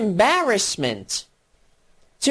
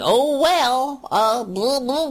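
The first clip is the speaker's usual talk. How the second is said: mocking Democrats; 4 semitones higher, slower than usual.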